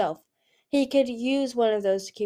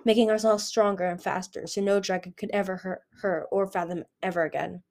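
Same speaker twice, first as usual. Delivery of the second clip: ordinary delivery, nothing marked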